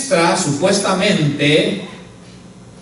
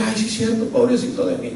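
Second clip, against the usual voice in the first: higher and softer, mock self-pitying whine; 5 semitones higher, 5 dB quieter, faster than usual. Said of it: "nunca vimos autocompasión". (queja burlona de autocompasión, más aguda y suave)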